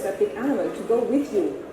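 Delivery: usual speaking voice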